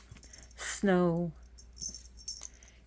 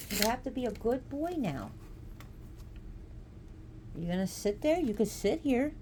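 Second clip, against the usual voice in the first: high sing-song pet talk, gently scolding; cooing to the cat; 5 semitones higher than usual. Real, not acted. Talking to her cat.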